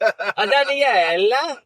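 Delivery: high, drawn-out fake Italian accent; hammed-up Italian, stretched and high; mock Italian accent. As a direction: sing it out slowly like a stage Italian. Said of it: "with my authentic Italian accent".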